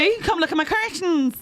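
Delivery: put-on high-pitched comic Irish accent; mock Irish woman. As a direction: call it out as a sketch-show Irish woman. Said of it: "that classic Irish woman".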